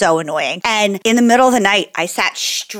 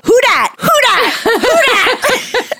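high, loud chanted who dat; sleep talking; 3 dB louder, slower than usual. Retold or imitated. Husband quoting her eerie sleep talk, high and loud. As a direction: repeat it like a spooky sleep-talker. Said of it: "you kept saying".

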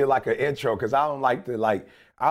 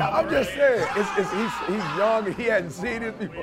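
laughing; 7 semitones higher, slower than usual. Real, not acted. Teasing friend while laughing.

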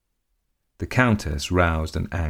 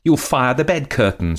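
much higher, louder panicked cry; desperate shrill; 8 semitones higher, 5 dB louder than usual. A narrator's reading. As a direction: shriek to stop him. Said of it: "she shrilled desperately".

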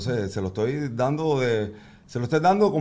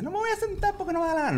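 much higher, fast mock-defiant little girl; bratty toddler voice; 4 dB quieter, faster than usual. Dad mimicking his daughter refusing to sit, high and bratty. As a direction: whine it like a three-year-old refusing.

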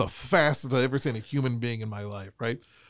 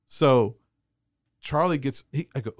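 ordinary talk

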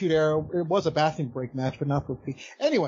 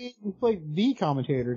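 THE stretched out long and emphatic; stress on THE; 3 semitones higher, slower than usual. Real, not acted. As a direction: correct them, drawing out "the". Correcting "played a commentator.

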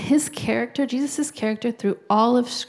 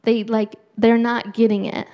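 louder, driving sermon push; preaching at full force; 5 dB louder than usual. Acted. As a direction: hammer the point home to the congregation. Preaching peak on Pharisees.

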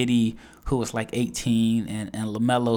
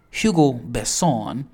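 "Hugo Besson" slow, in a light French accent; playful French accent; slower than usual. Shown as a demonstration. Naming his sleeper pick in a light French accent. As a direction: draw out "Besson" the French way, stress at the end. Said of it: "having fun with the accent".

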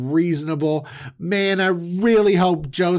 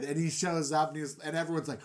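normal talking voice

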